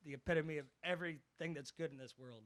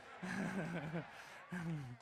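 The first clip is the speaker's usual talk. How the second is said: short laugh; 2 semitones higher than usual. Real, not acted. Laughs before teasing groom.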